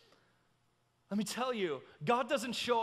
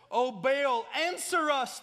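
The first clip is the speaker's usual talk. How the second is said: raised, loud cry to Baal; shouted plea; 4 semitones higher, 7 dB louder, slower than usual. A narrator's reading. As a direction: call out to a god who won't answer. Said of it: "they shouted".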